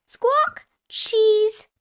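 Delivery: very high parrot squawk; Polly Parrot. A narrator's reading. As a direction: squawk the word back like a parrot. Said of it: "Polly Parrot replied".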